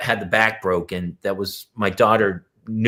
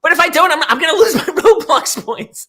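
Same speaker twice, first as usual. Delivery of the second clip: put-on very high, loud, laughing whine of a kid; whiny kid voice; 7 dB louder than usual. Mother mimics her daughter begging to keep her points, squeaky whine. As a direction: squeal it like a pleading child, laughing. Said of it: "That's what she says to me".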